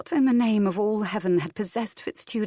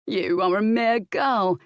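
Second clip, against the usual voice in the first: slow, louder sneer; 3 semitones higher, 4 dB louder, slower than usual. A narrator's reading. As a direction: sneer down at her. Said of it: "he sneered".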